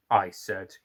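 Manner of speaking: plain reading voice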